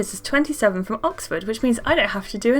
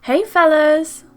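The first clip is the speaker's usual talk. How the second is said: put-on slow, louder greeting of the boys; loud girl character; 6 semitones higher, 6 dB louder, slower than usual. Girl greeting the new boys loudly. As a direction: call out to the boys.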